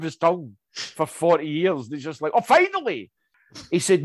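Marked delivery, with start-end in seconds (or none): 2.3-3.1 much higher hammed-up sigh of relief; mock relief. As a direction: play it as long-awaited release. Imagined relief of McGrath.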